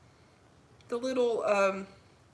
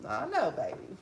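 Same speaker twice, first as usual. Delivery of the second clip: cooing to dog; 5 dB quieter than usual. Real, not acted.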